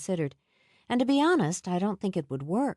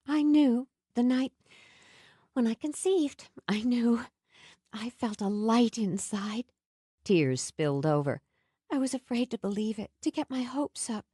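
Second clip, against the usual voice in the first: put-on higher, tearful happy young woman; joyful young woman; 5 semitones higher than usual. A narrator's reading. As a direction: tell it through happy tears. Nell voiced, tearful joy.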